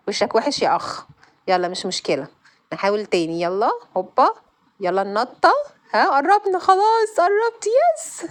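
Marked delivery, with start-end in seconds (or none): 5.9-8.2 much higher and louder, slowed cheer; excited squeal. Real, not acted. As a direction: squeal with joy as she reaches the end. About to finish the level. (فرحة عالية وصوت مرتفع جدًا)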